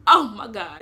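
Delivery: pained oh my God. Retold or imitated. Lying on the floor after slipping, loud pained cry. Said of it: "laying there like".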